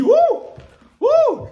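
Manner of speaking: high, loud "wouh" whoop; delighted whoop. Retold or imitated. YouTuber reenacting his discovery of gossip, whooping. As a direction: whoop as if thrilled by juicy gossip.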